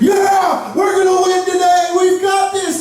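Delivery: high-pitched rallying cheer; fired-up soldiers, voice pitched high; pumped-up battle cry. Acted. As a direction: hype up the troops before battle. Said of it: "They're getting pumped up".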